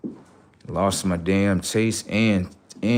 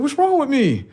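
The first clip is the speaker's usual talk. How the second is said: high whine; 3 dB louder than usual. Retold or imitated. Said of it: "I'm like".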